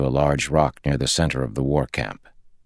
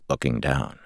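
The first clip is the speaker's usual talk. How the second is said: plain reading voice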